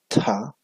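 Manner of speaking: "ta" with a strong puff of air; aspirated ta. Shown as a demonstration. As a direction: breathe out hard on "ta". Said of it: "la aspirada dental". ("ta" aspirada, con soplo fuerte)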